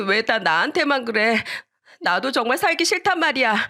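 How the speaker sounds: high, aggrieved outcry; protesting child. Acted. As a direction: wail back at the unfair scolding. Child cries back at grandfather.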